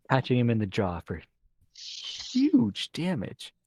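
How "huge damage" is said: dramatic. Acted. GM dramatic damage call.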